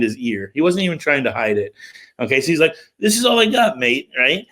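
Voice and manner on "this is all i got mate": high imitated British accent, shrugging it off. British friend showing police his spliff, British accent. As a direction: offer it up casually in a British accent. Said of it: "And he's got this British accent".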